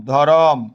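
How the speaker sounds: ধরম as "dho-rom", ending on m; inserted vowel in ধরম. Shown as a demonstration. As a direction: open an o between r and m in ধরম. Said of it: "ধর্ম থেকে". ("ধরম"-এ র-এর পরে টানা অ)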